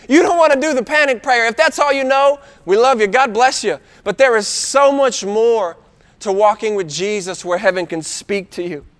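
usual speaking voice

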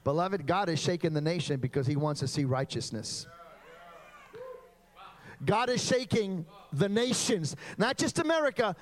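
fiery revival preaching; preaching at full force. Acted. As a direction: thunder it to the whole congregation. Preaching again, rising intensity.

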